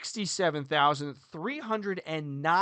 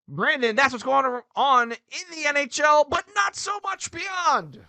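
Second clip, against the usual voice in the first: playful shouted sign-off; 11 semitones higher, 6 dB louder than usual. Pretend. Coach closing the NHL segment to Brandon, playful high shout. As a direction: yell the handoff, climbing higher to the end.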